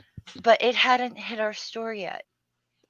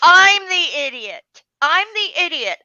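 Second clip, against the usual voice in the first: loud, high, falling on IDIOT; indignant retort; 7 semitones higher, 8 dB louder than usual. Retold or imitated. Bookseller retells answering a rude customer, indignantly loud. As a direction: land it as an outraged statement. Acted indignant comeback, repeated.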